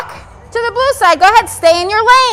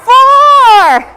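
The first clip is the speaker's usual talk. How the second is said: called out loud to the whole group; excited call; 5 semitones higher, 7 dB louder than usual. Real, not acted. Teacher announcing the number to her class, excited call. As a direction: announce it like a game-show reveal.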